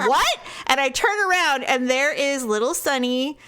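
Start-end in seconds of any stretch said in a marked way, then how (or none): 0.1-0.4 high, a bit louder startled what; mock baffled. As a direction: play the shocked wife. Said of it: "really mad".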